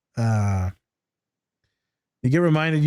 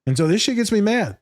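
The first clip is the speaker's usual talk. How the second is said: ordinary delivery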